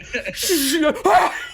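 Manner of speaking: sudden shrill laugh, way up high; high burst of laughter. Real, not acted. Terror at facing a jumbo jet.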